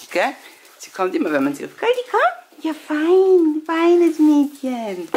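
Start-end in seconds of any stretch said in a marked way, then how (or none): 1.8-2.2 high call to the dog. Real, not acted. Calling the dog. 2.6-5.1 high, loud, slow praise; dog praise voice. Real, not acted.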